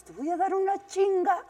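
high mock threat to the kids; playful mock threat; scolding mom. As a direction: pitch it up like a scolding mother. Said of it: "Siempre le decía yo a mis hijos".

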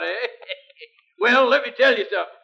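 ordinary talk

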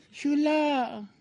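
soft, high, drawn-out "chulo"; sensual catchphrase. Retold or imitated. Imitating the singer's catchphrase, soft and sensual. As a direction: coo it seductively, stretched out. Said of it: "Él lo decía bien sensual".

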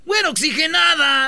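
shouted high and loud; mock shout. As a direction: call it out like a taunt. Calling out a mocking nickname.

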